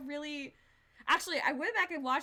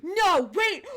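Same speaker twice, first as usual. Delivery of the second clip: loud, high burst of disbelief; shocked shout; 5 semitones higher, 12 dB louder than usual. Real, not acted. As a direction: cry out in sudden alarmed recognition. Shocked on recognising a game character.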